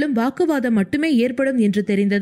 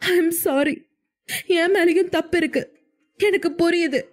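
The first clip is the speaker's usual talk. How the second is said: crying apology; 6 semitones higher than usual. A narrator's reading. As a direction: sob out the apology. Wife hugs husband, apologizing.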